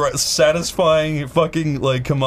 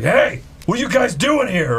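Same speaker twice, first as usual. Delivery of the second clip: higher-pitched mock challenge; retold angry guard; 8 semitones higher than usual. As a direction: imitate a guard pouncing on trespassers. Retold guard confronting the group.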